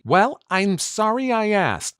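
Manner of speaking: hammed-up, high-pitched wounded comeback; mock huffy. Acting partner's line in a couple's fight, mock huffy.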